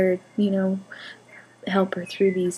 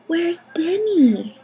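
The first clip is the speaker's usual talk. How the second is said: put-on high, slow, louder little-girl question; toddler voice; 9 semitones higher, 5 dB louder, much slower than usual. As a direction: ask like a curious tiny girl.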